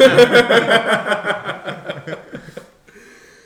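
long burst of loud laughter. Real, not acted. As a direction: laugh out loud, high and hard. Joking about rival club.

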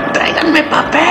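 high, imitating a dad yelling from the bathroom; mock desperate yell for toilet paper. Dad stuck in the bathroom yells to his kids, high mock shout.

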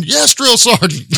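high, slow barked recruit reply; barked military recruit reply. Retold or imitated. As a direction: answer like a scared recruit. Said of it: "I'm like".

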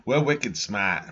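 slow mock Boston "wicked smaht"; Boston accent. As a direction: drawl it like a Bostonian. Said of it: "I'm from Boston".

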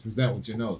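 ordinary talk

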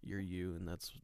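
soft, soothing reassurance; relaxing. Real, not acted. Co-host tells listeners they are lovable, relaxing soft voice. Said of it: "your voice is relaxing".

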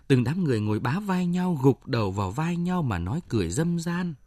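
ordinary delivery, nothing marked

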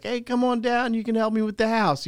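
high, thick New Jersey uncle voice; imitating uncle with a New Jersey accent. Uncle calling nephew over, New Jersey accent. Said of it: "That's my New Jersey accent".

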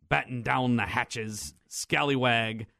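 slow drawn-out pirate call; pirate talk. Pretend. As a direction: stretch it like an old sea dog. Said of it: "Good pirate talk there".

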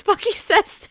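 plain reading voice

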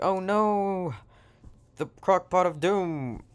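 high, drawn-out mock alarm; mock dread. Villagers see the crock pot, mock dread.

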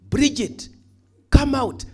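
loud, high-pitched summons; calling out. Acted. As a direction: call her out at full voice. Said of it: "I come here and I say".

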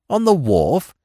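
sharp question. A narrator's reading. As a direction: bark the question in surprise. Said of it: "snapped Smith".

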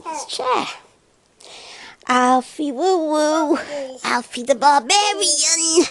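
high, slow singsong coo. Real, not acted. Cooing at baby.

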